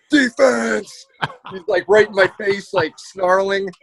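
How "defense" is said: mock defensive shout. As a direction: yell it like a coach on the floor. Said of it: "slap the ground".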